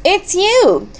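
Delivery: high-pitched exclamation of recognition; excited cry. A narrator's reading. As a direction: exclaim it high, as if just spotting a friend. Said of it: "cried Mouse".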